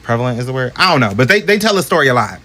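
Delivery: normal talking voice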